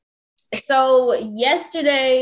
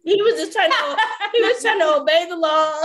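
laughing; 5 semitones higher than usual. Real, not acted. Laughing at her own story.

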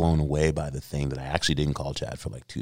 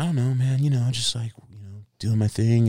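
higher, noncommittal slacker reply; sheepish answer; 7 semitones higher than usual. Retold or imitated. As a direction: dodge the question in a high, vague voice. Retold sheepish answer to Chad.

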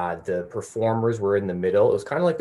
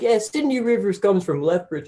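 mid-joke comic voice; 10 semitones higher than usual. Retold or imitated. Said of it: "You're like".

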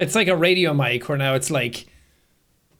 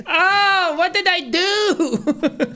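mock panicked; 12 semitones higher, much slower than usual. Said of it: "And then you're like".

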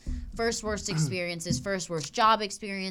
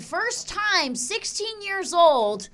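much higher, louder, slow building outrage; outraged punchline; 10 semitones higher, 8 dB louder than usual. Real, not acted. Telling her cohost the doctor's plate said player, high and loud. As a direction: hammer the punchline in disbelief.

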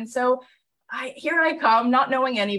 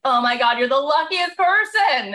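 gushing friends; 6 semitones higher, 3 dB louder than usual. Retold or imitated. Friends gushing over her success.

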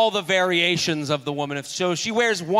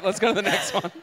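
ordinary talk

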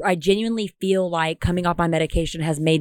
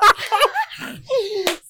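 high giggling burst; real amused laugh. Real, not acted. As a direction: laugh at own joke. After Coco Melon joke.

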